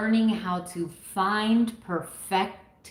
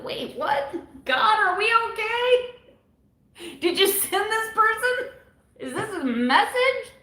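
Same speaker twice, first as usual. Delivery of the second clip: high, bewildered mock disbelief, laughing at the end; stunned disbelief; 12 semitones higher than usual. Vlogger retelling her reaction to a critic, shocked high disbelief. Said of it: "I'm like".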